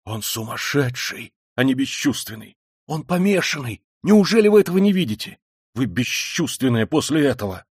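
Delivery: urgent, rising protest; heated whisper. A narrator's reading. Razumikhin at Dunya's ear, hotly urgent. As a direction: argue hotly, pitch climbing to a peak. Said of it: "горячо прошептал Разумихин над самым её ухом".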